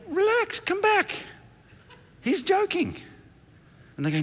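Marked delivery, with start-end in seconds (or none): 0.1-3.0 put-on high, light girlish voice, laughing; high daughter voice. Daughter calling friends back, high and laughing. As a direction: mimic the daughter reassuring, pitched way up. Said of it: "my daughter's going".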